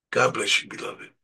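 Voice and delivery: normal talking voice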